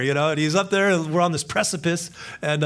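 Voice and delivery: usual speaking voice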